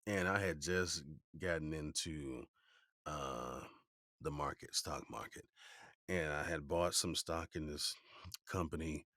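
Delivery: ordinary talk, nothing marked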